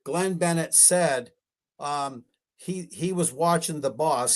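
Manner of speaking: normal talking voice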